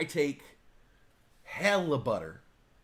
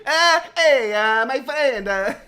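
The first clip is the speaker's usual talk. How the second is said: high, slow, hammed-up Italian accent with laughs; mock Italian accent; 10 semitones higher, 8 dB louder, slower than usual. Host greeting a friend, hammed-up Italian accent. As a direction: lay on a thick Italian accent, drawn out. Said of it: "he doesn't have to talk like that".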